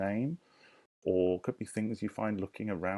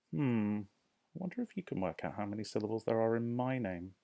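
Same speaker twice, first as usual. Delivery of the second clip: ordinary talk, nothing marked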